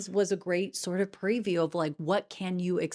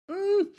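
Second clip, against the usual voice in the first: doubtful hum; 11 semitones higher, 4 dB louder than usual. Retold or imitated. Doubtful reaction to singing.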